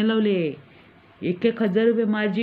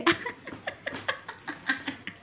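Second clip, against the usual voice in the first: breathy gloating snicker; 8 semitones higher, 9 dB quieter than usual. Acted. Gloating after scamming story.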